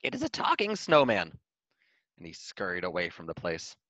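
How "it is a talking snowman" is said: frightened cry. Acted. Frightened hedgehog after shriek.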